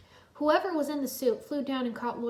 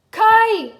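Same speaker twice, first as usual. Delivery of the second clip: very high, loud call; cry out; 11 semitones higher, 18 dB louder than usual. A narrator's reading. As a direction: call for the blade. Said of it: "Lloyd cried".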